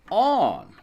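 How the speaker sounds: ordinary talk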